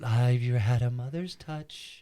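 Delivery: slow, low, soothing mother voice; mother's comfort. Retold or imitated. Quoting the cat lady comforting Art, slow soothing voice. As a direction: coax him gently like a mother. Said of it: "she's like".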